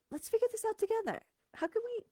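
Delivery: high, soft, patient divine voice; lightly voiced God, high and soft; gentle God voice. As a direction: speak as a kind God inviting teamwork. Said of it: "God, is like".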